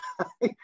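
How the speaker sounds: sudden high-pitched laugh; short squeaky laugh; high burst of laughter. Real, not acted. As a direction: laugh out at the absurdity. Laughing at jelly babies.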